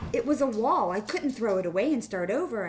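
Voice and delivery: loud, high mock despair; dramatic crisis. A narrator's reading. Teacher dramatizes wall mistake to student, loud and high. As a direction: play up the disaster for the kids. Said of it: "dramatically to stress the extent of my crisis".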